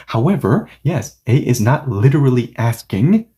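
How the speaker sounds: ordinary talk, nothing marked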